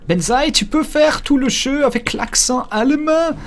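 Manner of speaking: put-on high, slow comic German accent; Didier Gustin's Hitler, German accent. Viewer's request to gamer, mocking high German accent. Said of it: "mon accent allemand, hein, c'est l'imitation".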